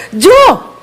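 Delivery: high, sudden holler; short high-pitched morning shout; mock yell. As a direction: call out sharply to a husband. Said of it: "saya teriakin dia".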